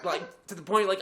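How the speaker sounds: ordinary talk, nothing marked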